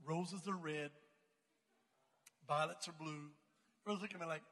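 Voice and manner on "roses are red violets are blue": low, halting poem recital; deliberate poem recital. Retold or imitated. Standing up to recite a rhyme for the class, low and deliberate. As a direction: recite it low, one line at a time.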